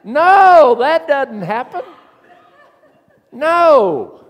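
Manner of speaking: high, loud laughing denial. Real, not acted. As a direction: crack up while saying no. Laughing denial of reversed call.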